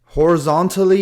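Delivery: horizontally said high and loud as a correction; stress on horizontally. Real, not acted. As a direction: punch horizontally to fix the slip.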